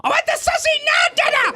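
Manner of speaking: put-on very high shouted child's whine; whiny toddler demand. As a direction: screech it like an impatient toddler. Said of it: "of course, they want it now".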